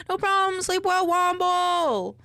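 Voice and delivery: high, loud goodbye with a drawn-out ending; loud singsong farewell; cheery send-off. Real, not acted. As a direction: call it out high and slow to someone leaving. Sending off a chatter to sleep.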